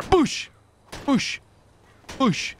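high punchy boosh effect; mock impact sound. Gamer voicing hits on a friend's car, high boosh. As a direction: punch out each boosh like a hit.

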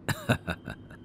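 quiet breathy chuckle of delight; soft chuckle. Acted. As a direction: chuckle softly, charmed. Charmed by listener's name.

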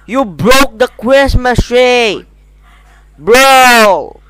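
loud, shocked yelling; shouting. Real, not acted. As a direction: yell in disbelief. Reacting to a broken Christmas tree.

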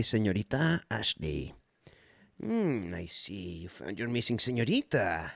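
ordinary talk

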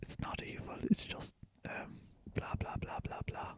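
hushed whisper. Shown as a demonstration. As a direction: whisper it close to the mic. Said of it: "If I can whisper".

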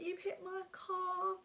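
high, slow sobbing wail; hysterical crying. Retold or imitated. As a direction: wail it slowly through sobs. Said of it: "I was literally hysterically crying".

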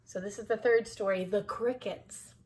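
plain reading voice

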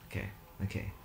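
breathy scared whisper. Real, not acted. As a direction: whisper to calm yourself. Scared, not looking.

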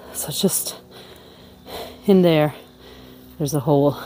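breathless after climbing to peep in; winded, catching breath between phrases. Real, not acted. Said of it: "I'm kind of out of breath now".

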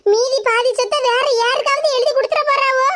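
normal talking voice

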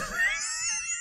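high, shrill shriek of laughter; sudden squealing laugh. Real, not acted. Laughs while removing tape.

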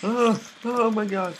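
loud high panicked cry; frightened scream. Real, not acted. Player ambushed by a spider, frightened shout. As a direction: shriek then gasp it out in fright. Monster appears suddenly.

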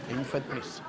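hushed deadpan repeat; soft aside. Acted. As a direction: mutter it under your breath.

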